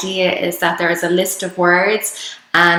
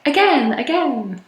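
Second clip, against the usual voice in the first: put-on light, higher toddler voice on "Again, again"; imitating her daughter; 7 semitones higher than usual. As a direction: echo a little girl asking for more. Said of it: "one of my daughter's favorite words".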